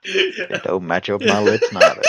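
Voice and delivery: slow laughing Western drawl; cowboy accent. Pretend. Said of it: "sounds more like a cowboy".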